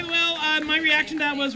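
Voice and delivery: ordinary talk, nothing marked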